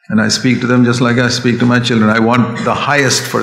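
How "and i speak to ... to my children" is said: ordinary talk, nothing marked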